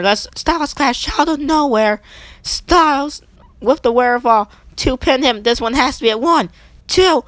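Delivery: energetic wrestling announcer calling the action; play-by-play commentary. Acted. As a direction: call the match live like a ringside commentator.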